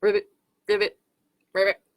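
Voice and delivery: short, separate ribbits; frog ribbit. Pretend. Making frog sounds for children, playful ribbits.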